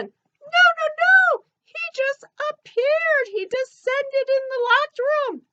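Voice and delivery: high, squeaky cartoon elephant. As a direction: chirp it like a little elephant puppet. Ellie corrects the pastor.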